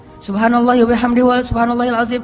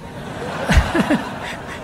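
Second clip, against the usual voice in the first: breathy laugh; 3 semitones higher, 5 dB quieter than usual. Real, not acted. Laughs at own joke.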